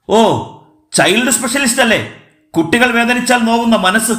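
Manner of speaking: high-pitched sarcastic jeer; mocking. A narrator's reading. Man taunts his child-specialist uncle, mockingly. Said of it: "Hemachandran പരിഹസിച്ചു".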